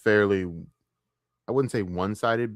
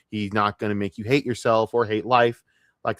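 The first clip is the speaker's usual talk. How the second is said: ordinary talk, nothing marked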